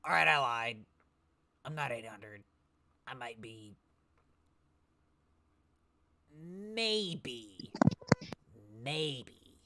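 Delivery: lightly voiced old man, hedging; a hint of a sly old man caught lying; haggling old man. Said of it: "old man".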